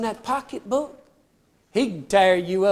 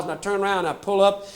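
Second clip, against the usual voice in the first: ordinary talk, nothing marked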